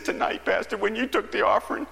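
high, crying-man imitation; tearful man. Man explains his upset to pastor, tearful imitation. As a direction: squeak it out as someone imitated mid-cry. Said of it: "he was just a-crying".

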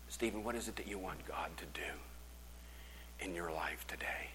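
soft, unvoiced whisper; a pastor whispering to a kneeling man; tender whisper. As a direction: ask gently, close and private. Pastor asks softly at altar.

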